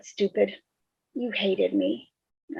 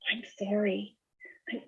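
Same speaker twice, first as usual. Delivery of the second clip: softer, broken whisper; whispered apology; 10 dB quieter than usual. Acted. Sister whispering sorry at her brother's grave. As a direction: breathe the apology out.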